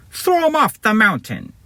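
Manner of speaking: high, put-on henchman's order; annoying villain voice. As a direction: give the order in a high, irritating voice. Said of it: "Guy one's annoying voice".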